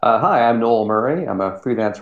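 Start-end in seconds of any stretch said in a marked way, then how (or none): none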